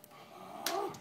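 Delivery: high, voiceless gasp of alarm; acted startled gasp. Acts startled bug seeing silhouette.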